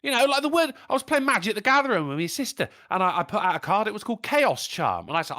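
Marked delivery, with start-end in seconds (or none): none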